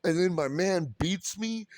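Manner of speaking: usual speaking voice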